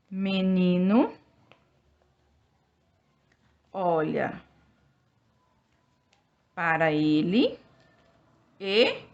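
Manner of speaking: word by word, long pauses between; each word stretched and left hanging; slow dictation. Shown as a demonstration. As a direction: dictate slowly to children copying. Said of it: "teacher dictating while writing on board".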